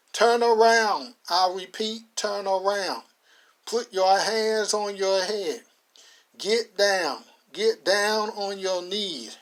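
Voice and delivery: slow, deliberate barked orders; police command. Acted. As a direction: lay down each instruction firmly. Sheriff orders arrest.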